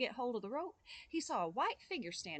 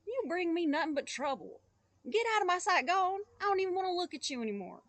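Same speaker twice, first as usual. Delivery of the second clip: loud, high, fast at the end; furious father; 8 semitones higher, 7 dB louder than usual. Acted. Father sending his son away, furious. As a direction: throw the boy out.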